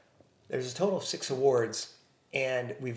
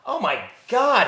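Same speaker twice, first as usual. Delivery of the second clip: high, slow, moved gasp; overwhelmed by the kind words; surprised; 10 semitones higher, 8 dB louder, slower than usual. Real, not acted. Touched by quote.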